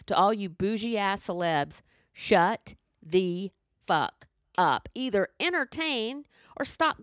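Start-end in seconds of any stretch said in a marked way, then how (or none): 2.1-4.9 each word of "shut the fuck up" spaced out and hammered, very slowly; slow emphatic rant. Real, not acted. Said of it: "I'll stop screaming now".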